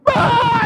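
high, loud overcome cry ah; emotional cry. Acted. Pastor reliving being overwhelmed while prayed for, loud high cry.

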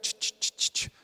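repeated voiceless "ch" clicks; quick mechanical ticking made by mouth; imitated clicking. Optician adjusting the lenses, imitated clicks.

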